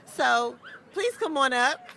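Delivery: ordinary talk, nothing marked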